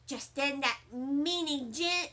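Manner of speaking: plain reading voice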